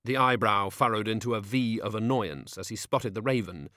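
plain reading voice

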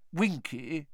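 soft, gentle call; kindly. A narrator's reading. Mister Weasley gently addressing the frightened elf. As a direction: say her name kindly. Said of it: "he said kindly".

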